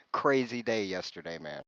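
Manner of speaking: ordinary delivery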